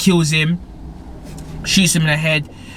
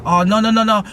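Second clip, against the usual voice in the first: pleading; 6 semitones higher, 4 dB louder than usual. Retold or imitated. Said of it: "the girl was like".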